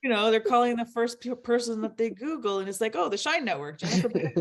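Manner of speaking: giggling at how absurd it got; amused laughter while telling it; laughing. Real, not acted. Laughing at absurd panel demand.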